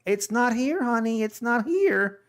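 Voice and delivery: high, innocent feigned cluelessness; husband playing dumb to his wife, high and innocent. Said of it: "you just play the dumb card".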